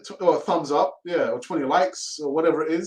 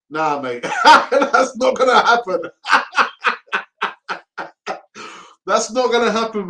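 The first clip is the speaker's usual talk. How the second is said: laughing refusal; 6 semitones higher, 6 dB louder than usual. Real, not acted. Fan laughing off giving away his Brady ticket, real laughter.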